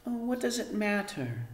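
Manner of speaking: put-on soft, high careless girl voice; Arrietty. A narrator's reading. Daughter brushing off mother's worry, soft high careless. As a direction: shrug it off lightly. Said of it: "said Arrietty".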